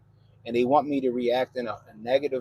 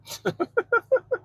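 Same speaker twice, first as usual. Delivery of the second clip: high burst of laughter. Real, not acted. Laughs at chat message.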